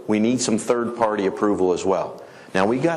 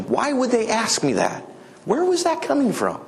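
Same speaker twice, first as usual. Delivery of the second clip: much higher, bewildered asking; puzzled question; 12 semitones higher than usual. Retold or imitated. Farmer voicing the puzzled question he should ask, much higher. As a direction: voice the confusion, pitched way up.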